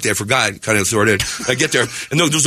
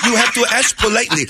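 high-pitched imitation of mom; raised-pitch motherly instruction; prim mom; 8 semitones higher than usual. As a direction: play a mother correcting manners. Mom replies in acted quote.

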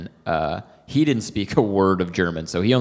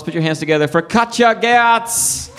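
higher, announcer-style build; host intro; 11 semitones higher, 4 dB louder than usual. Real, not acted. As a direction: call the guest on stage. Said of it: "ladies and gentlemen".

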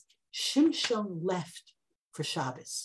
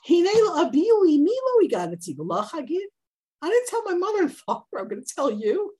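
tactless husband; 8 semitones higher, 5 dB louder than usual. Retold or imitated. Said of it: "in a brilliant display of tact".

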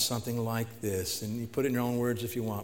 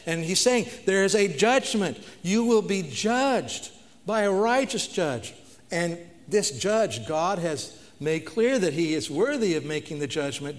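preaching at full force; 5 semitones higher, 6 dB louder than usual. Acted. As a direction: hammer home the coming judgment. Preacher warns of the judge.

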